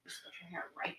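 unvoiced hushed aside; whisper. Real, not acted. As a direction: whisper it under your breath. Quiet aside while moving chair.

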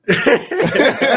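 high, loud rising laugh. Real, not acted. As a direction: crack up at your own joke. Laughs at own joke.

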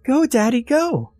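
put-on excited child. A narrator's reading. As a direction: squeal like a kid riding on shoulders. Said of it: "she laughed loudly".

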